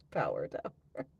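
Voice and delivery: giggling through the words Power tower; laughing. Real, not acted.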